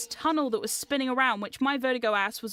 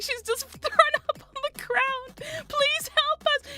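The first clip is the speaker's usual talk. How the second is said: high, slow, giggle-strained begging; laughing plea; 13 semitones higher, slower than usual. Retold or imitated. Friends begging chainsaw actor for sick friend, pleading through laughter. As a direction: beg for help while cracking up.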